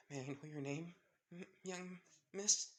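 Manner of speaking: mildly sad, halting question; soft, sad smile. A narrator's reading. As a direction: ask gently through a sad smile. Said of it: "softly, sadly smiling".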